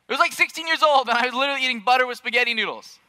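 incredulous high energy; animated confession. Real, not acted. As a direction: blurt it out, amazed at his own pickiness. Excited picky-eater confession.

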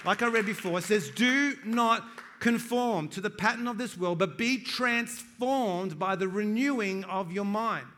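loud, lifted preaching voice, punching the key lines; preaching at full force. Acted. Pastor exhorting his church to read the Word, full-force preaching. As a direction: preach it out to the whole room. Pastor reading scripture with fervour.

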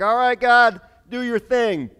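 loud, high, rousing call upward; full confidence. Retold or imitated. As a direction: call on God like a showman. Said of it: "he's feeling it".

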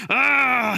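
loud, high cry of frustration; frustrated yell. Acted. Squirrel upset with stores and monster, frustrated yell. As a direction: yell it out in exasperation. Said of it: "he started getting frustrated".

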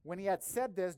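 ordinary talk

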